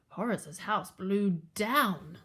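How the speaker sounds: usual speaking voice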